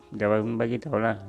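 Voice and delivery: plain reading voice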